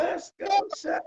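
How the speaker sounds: high-pitched pretend cheer squad voice; mock cheerleader. Joking along about pom-pom cheering for the chef, mock cheerleader. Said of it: "cheering you on the entire way".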